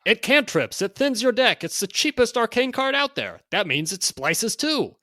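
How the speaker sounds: loud, high hyped sales pitch; carnival barker. Acted. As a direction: hawk it like a sideshow barker. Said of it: "read this in sort of a carnival barker type voice".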